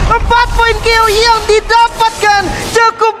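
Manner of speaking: screaming, stretched-out hype call; fighting game announcer. Real, not acted. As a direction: roar the kill call to the crowd. Caster calls a maniac kill.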